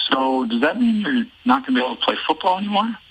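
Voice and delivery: put-on a high, worried kid voice, lightly done; a hint of a puzzled little boy. Dad voicing his son's question about football, high and puzzled. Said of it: "He's like".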